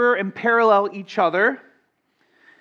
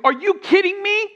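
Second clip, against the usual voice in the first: "Are you kidding me?" pitched up high; high disbelief; 9 semitones higher than usual. Shown as a demonstration. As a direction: throw it up in amazement. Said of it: "that's going to lead us to".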